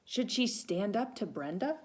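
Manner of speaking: ordinary delivery, nothing marked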